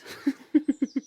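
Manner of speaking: a short self-amused laugh at his own joke; king laughing at his own wry remark about the women; light chuckle. Acted.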